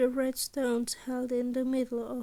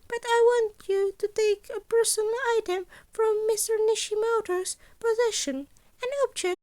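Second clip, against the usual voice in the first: high, louder principal laying out the heist; Nezu voice; 9 semitones higher, 6 dB louder than usual. Acted. Principal briefing students on the gala heist, high and bright. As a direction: pitch it up like a cheerful small headmaster.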